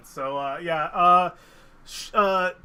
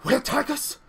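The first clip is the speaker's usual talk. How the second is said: a high, startled question; alarmed question after hearing of assassins; 10 semitones higher, 5 dB louder than usual. Acted. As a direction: ask in sudden alarm. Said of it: "her eyes widen".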